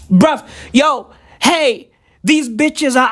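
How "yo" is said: normal talking voice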